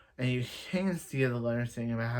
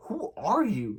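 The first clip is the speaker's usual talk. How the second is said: higher pitched, taken aback "who are you"; startled question; 6 semitones higher than usual. Retold or imitated. Sarah meeting an unknown attacker, startled. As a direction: ask it as if facing a stranger. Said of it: "Sarah would have said".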